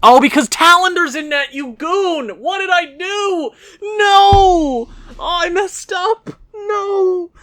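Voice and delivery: high, loud exasperated yells at the goalie; frustrated yelling. Real, not acted. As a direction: yell at the screen in disbelief. Goalie blunder, screaming at screen.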